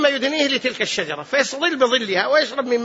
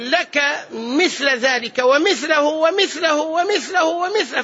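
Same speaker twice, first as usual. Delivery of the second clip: drawn-out, raised stress on each "ومثله"; emphatic piling up; 4 semitones higher, slower than usual. Retold or imitated. God multiplying the gift to His servant, emphatic piling up. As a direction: stretch "لك" and "مثل", then let every "ومثله" mount slowly.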